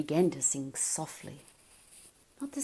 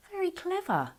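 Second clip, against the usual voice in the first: Lila's voice; 14 semitones higher than usual. Acted. Lila teasing.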